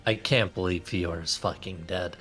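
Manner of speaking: ordinary talk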